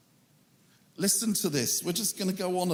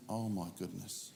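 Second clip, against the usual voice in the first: much lower and softer, as if overcome; moved, voice dropping; 11 semitones lower, 11 dB quieter than usual. Real, not acted. Preacher overcome reading Paul's hardships, hushed and moved. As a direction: let the words catch, hushed and moved.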